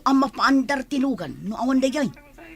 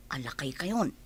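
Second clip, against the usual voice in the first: whisper; 11 semitones lower, 11 dB quieter than usual. Acted. Teasing boss about old-man disguise.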